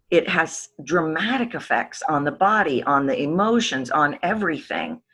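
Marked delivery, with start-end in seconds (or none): none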